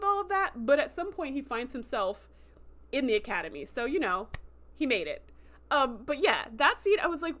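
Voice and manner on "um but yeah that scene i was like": normal talking voice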